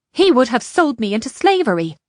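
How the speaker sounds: heated, forceful outburst; angry shout. A narrator's reading. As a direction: shout it back angrily. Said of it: "Ishrak shouted at her angrily".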